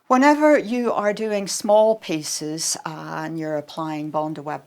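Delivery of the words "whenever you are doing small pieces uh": ordinary delivery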